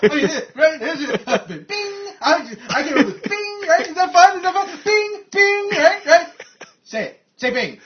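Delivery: manic hype. Retold or imitated. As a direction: push everyone to chant it. Boss hyping bing, demanding it.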